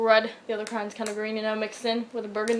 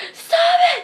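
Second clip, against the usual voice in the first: shrill, very high, loud shriek; screaming; 11 dB louder than usual. Retold or imitated. Girl screaming at boy punching her, loud shriek. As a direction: scream it at the kid. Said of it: "And I'm screaming".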